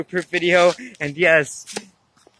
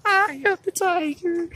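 high, slow hammed line; silly high voice; 14 semitones higher than usual. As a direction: ham it up high. Goofy performed line.

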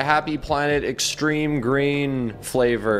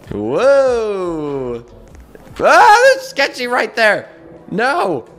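high, loud startled yelp; startled shriek; 10 semitones higher, 8 dB louder, much slower than usual. Real, not acted. Streamer startled as Sketchy appears, loud high yelp. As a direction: jump at the sight and yell.

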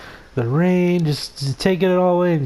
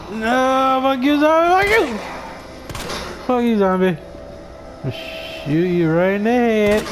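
panicked cursing; 7 semitones higher than usual. Real, not acted. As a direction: lash out at the zombie. Fighting off a zombie.

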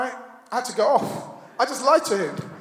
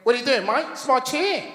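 higher-pitched Australian impression; Australian accent; 5 semitones higher than usual. Australian guy challenges comedian over a chair, mock Australian accent. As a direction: imitate an indignant Australian. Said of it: "that's how they sound".

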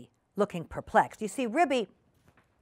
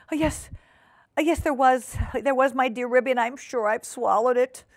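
high, whining insistence; panicky guest Duchess; 5 semitones higher than usual. Acted. Duchess insisting to Ribby, panicky whine. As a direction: wail that it is so.

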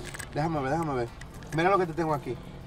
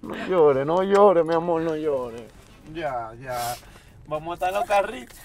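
soothing comfort; 10 semitones lower, 3 dB quieter, slower than usual. Acted. Comforting crying girlfriend.

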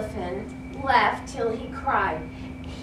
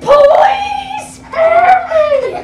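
high loud slow wail, begging; pleading wail; 12 dB louder, much slower than usual. Acted. Elephant begging the mouse, slow high wail. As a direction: drag out the plea in mock terror. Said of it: "shouted the elephant".

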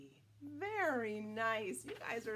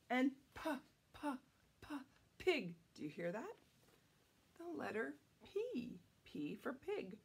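ordinary delivery, nothing marked

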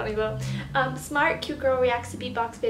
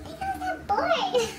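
mocking high voice. Mocking voice of a comment.

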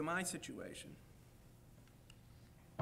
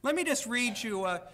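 ordinary talk, nothing marked

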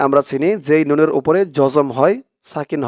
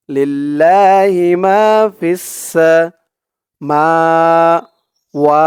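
slow chanted লিল্লাহি মা; teacher's slow recited model; recited demo; 3 dB louder, much slower than usual. Shown as a demonstration. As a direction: draw out লিল্লাহি মা slowly as in recitation. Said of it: "teacher recites model".

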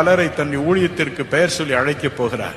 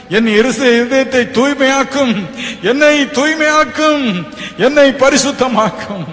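crying out in prayer; 9 semitones higher, 7 dB louder than usual. Shown as a demonstration. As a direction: beg God for forgiveness at full voice. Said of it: "அவரை நோக்கி கதறுங்கள்". (கதறி மன்றாடும் ஜெபம்)

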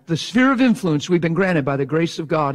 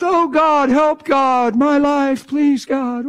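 mock desperate prayer; 8 semitones higher, much slower than usual. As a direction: ham up a helpless prayer to God. Mocking desperate whiny prayer.

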